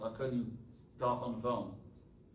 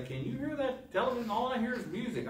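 roommate's question in a higher, lilting voice; imitated roommate; 10 semitones higher than usual. Roommate asks the preacher about the television, imitated in a higher voice. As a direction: mimic the roommate, pitched well up.

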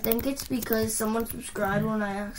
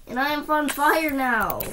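usual speaking voice

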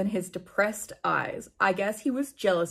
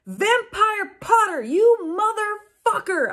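much higher, louder, slow hammed-up yell; mock yell; 12 semitones higher, 4 dB louder, slower than usual. A narrator's reading. Heroine storming into the classroom, hammed-up yell. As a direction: bellow it like a soap-opera villain. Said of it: "I yelled".

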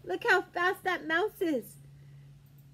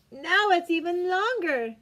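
ordinary delivery

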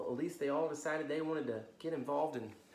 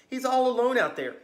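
worried; 10 semitones higher, 13 dB louder than usual. Acted. Rainbow Fish worried.

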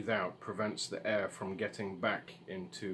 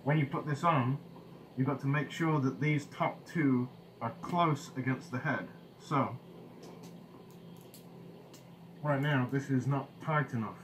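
muffled behind respirator; through a gas mask; 5 semitones higher, 4 dB louder than usual. Real, not acted. Seen 2: Mask is worn over his face throughout; R frames only show it handheld.

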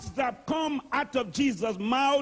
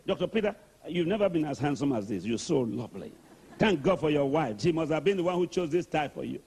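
low, fast and friendly; butter him up warmly; Jesus flattering kindly; 6 semitones lower, faster than usual. Retold or imitated. Jesus complimenting Doctor Peter on his looks, low and friendly. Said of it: "Jesus will talk like that".

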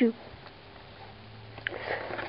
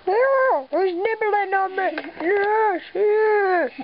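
excited squeal; 6 semitones higher, much slower than usual. Real, not acted. Guinea pig nibbling her.

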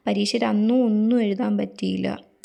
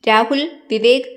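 calling out; 7 dB louder, slower than usual. Acted. Calling out names.

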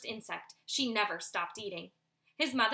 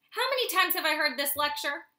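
usual speaking voice

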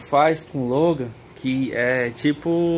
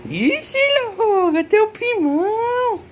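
much higher, slow squeal of delight; excited teasing; 4 dB louder, slower than usual. Real, not acted. Owner squealing at his dog about the cousin.

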